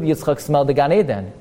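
ordinary talk, nothing marked

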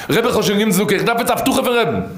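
acted eager answer, pitched high; imitating an over-eager petitioner; eager reply. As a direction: answer the Rebbe in a high, eager rush of assurance.

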